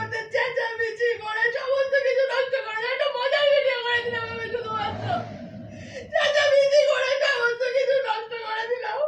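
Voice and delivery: wailing through real sobs; crying. Real, not acted. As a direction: sob out the words in one long cry. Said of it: "দেখো, এটা কাঁদছে".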